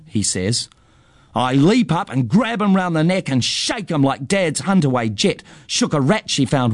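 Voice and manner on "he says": plain reading voice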